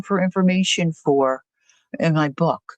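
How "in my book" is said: usual speaking voice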